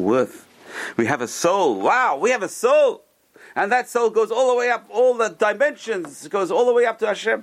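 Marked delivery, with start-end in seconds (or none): 1.8-7.4 awed. Real, not acted. Awe at the soul.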